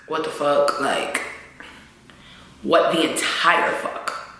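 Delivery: low, hushed disbelief; stunned hush. Real, not acted. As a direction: drop to a stunned near-whisper. Stunned by the death.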